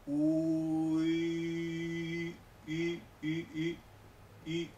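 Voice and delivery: from u into a held rounded i; u gliding to ü. Shown as a demonstration. Teacher drills ü, keeping lips rounded from u. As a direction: keep u lips and move tongue to i.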